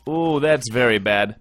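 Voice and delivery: ordinary talk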